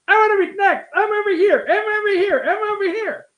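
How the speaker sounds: high mock reporter yelling to be picked; imitating shouting reporters. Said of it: "They're all shouting all over each other".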